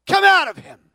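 high, loud command; Jesus rebuking the spirit, loud command. Acted. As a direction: cast it out with authority. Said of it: "the master spoke".

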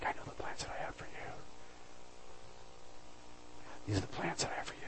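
whispered names and the promise, fast; intimate whisper. Acted. Pastor whispering God's promise to each listener by name.

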